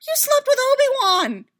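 very high, loud outburst; anguished, jealous shout; shouted accusation. Acted. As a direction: yell the accusation at her. Accusing a lover of infidelity.

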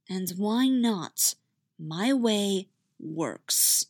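very slow cold reply. Acted. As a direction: drawl it coldly.

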